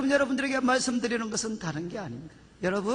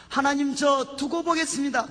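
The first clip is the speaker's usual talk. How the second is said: raised, more forceful prayer to God; firm confession; 3 semitones higher than usual. Shown as a demonstration. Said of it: "우리가 주님 앞에 고백하는 겁니다".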